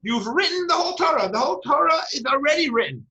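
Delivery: high-pitched, quick retold protest; acted-out astonished objection; incredulous protest. Retold Moshe protesting to God.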